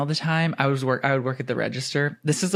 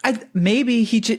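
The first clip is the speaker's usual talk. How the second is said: ordinary talk, nothing marked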